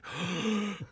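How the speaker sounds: mock horrified gasp; one sharp gasp, imitating a stunned group; shocked gasp. Boy imitates everyone freezing at the word, shocked gasp. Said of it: "everybody froze, like".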